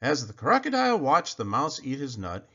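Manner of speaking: ordinary delivery, nothing marked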